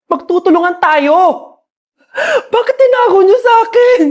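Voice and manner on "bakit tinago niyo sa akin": tearful plea. Acted. Mother hurt her children hid things.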